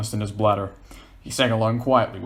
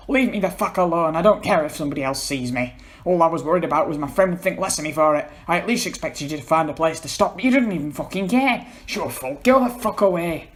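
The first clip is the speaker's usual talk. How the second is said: furious; 7 semitones higher, 4 dB louder than usual. Acted. Paul furious at John.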